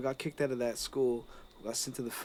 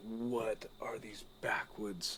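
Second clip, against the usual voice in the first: awed whisper; 5 semitones lower, 10 dB quieter, much slower than usual. Retold or imitated. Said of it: "I was like".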